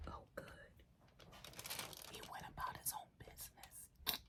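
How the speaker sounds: hushed relieved whisper. Real, not acted. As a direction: breathe it so he won't hear. Relieved once the neighbor passes.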